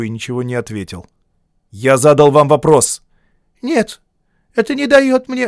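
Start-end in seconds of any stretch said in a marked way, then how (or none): 1.7-3.0 stern demand. A narrator's reading. Deputy presses boy. 3.6-5.5 put-on thin, high lisping boy's voice; a thin lisping teenage boy; slushy lisp. A narrator's reading. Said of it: "с тонким шепелявым голосом".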